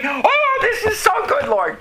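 a high, delighted cry of thanks; joyful relief. Retold or imitated.

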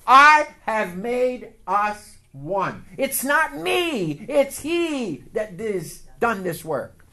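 slow, loud, emphatic words; slow preaching emphasis. Acted. Preacher stressing God's work to the church, slow and emphatic. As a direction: drive it home slowly to the congregation.

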